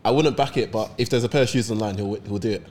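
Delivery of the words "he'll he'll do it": ordinary talk, nothing marked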